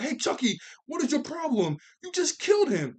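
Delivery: mock outrage, building to a yell; spazzing out. Twins yelling at Chucky over his dead friend, mock outraged. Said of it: "they start spazzing at Chucky".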